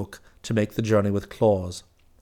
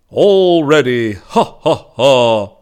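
loud, slow, jolly shout with a laugh; merry shout; 3 semitones higher, 12 dB louder, much slower than usual. A narrator's reading. Claus shouts merrily to the deer as they set off. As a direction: shout for joy as the sledge sets off. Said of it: "he shouted merrily".

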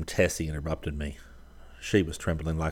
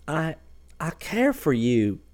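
put-on slow, higher, halting young woman's voice; Tessie's confession; 9 semitones higher, slower than usual. A narrator's reading. Tessie confessing her love to the painter, slow and halting. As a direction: falter on the first word, then say it slowly.

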